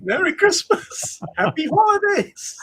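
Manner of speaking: high, slow sing-song holiday greeting; cheerful greeting. Real, not acted. Festive greeting to co-host.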